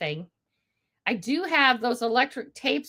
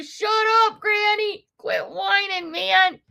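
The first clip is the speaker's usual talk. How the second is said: higher mock scolding of granny; mock viewers scolding; 8 semitones higher than usual. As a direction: tease the whining lady in a high voice. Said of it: "and you guys are going".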